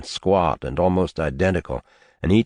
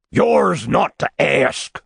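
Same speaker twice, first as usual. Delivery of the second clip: slow, heavy shout, much higher and louder; 11 semitones higher, 4 dB louder, slower than usual. Acted. Old man rebuking his nephew, slow thundering shout. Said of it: "the old man shouted".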